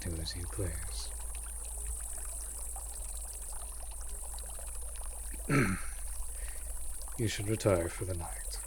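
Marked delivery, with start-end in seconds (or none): 1.1-7.1 throat clear. Acted. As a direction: clear your throat to cover awkwardness. Awkward pause before suggesting.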